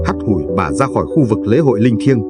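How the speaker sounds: ordinary delivery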